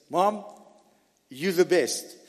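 usual speaking voice

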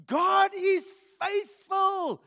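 slow, loud and high "God is faithful"; preached shout. Real, not acted. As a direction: proclaim it with full force. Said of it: "repeated, louder and slower".

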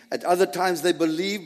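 ordinary talk

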